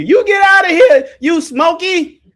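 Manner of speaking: high, loud shooing yell; Auntie yelling. Retold or imitated. Auntie shouting a smoker out of her house, high and loud. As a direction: chase the smoker out at the top of your voice. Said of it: "She'll call you".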